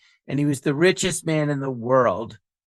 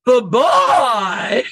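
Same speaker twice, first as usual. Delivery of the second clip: high sing-song goodbye; a hint of a silly TV character signing off; catchphrase voice; 6 semitones higher, 4 dB louder than usual. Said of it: "Do your catchphrase".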